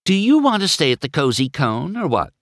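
brisk and direct; blunt. A narrator's reading. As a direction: put it to them bluntly. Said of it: "Sally asked bluntly".

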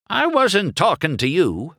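put-on high, indignant old lady car; snippy old car snapping back; prickly old-timer; Lizzie, huffy. Lizzie glared at the race car.

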